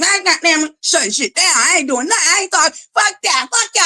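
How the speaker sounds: a hammed-up high-pitched meltdown; mock tantrum. Streamer fakes quitting on viewers, high mock tantrum.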